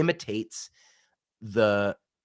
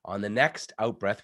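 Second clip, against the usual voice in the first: ordinary talk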